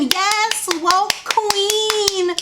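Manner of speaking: high, drawn-out imitated gushing; sarcastic fan cheer; mock fawning praise. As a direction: mockingly hail her, stretching each word up high.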